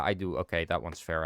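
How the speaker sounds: ordinary talk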